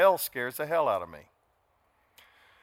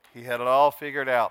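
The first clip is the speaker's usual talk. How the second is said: ordinary talk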